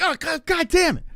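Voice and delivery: high strained groan of pain, mock; groaning curse. Skater fallen in the street groans a curse in pain. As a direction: groan it out like you just hit the pavement. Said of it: "like a groan".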